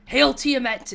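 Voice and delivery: loud, high mock hail; retold cultist greeting. Player retells greeting guards, loud mock hail to Tiamat. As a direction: hails the dragon goddess like a zealot. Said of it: "I said".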